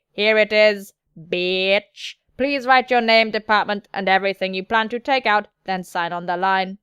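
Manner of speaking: steady, level robotic delivery; measured machine monotone; android voice. Acted. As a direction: recite it like an android, pitch held steady. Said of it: "Something about the android voice".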